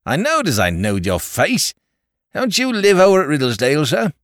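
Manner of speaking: homely, cheerful Cockney barman; Cockney landlord. A narrator's reading. Landlord reminiscing to the lord, cheerful Cockney chat.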